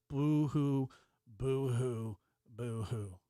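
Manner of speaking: mock his tears with a sneering boo-hoo; taunting pretend weeping that fades out; mock crying. Mocking a whining streamer.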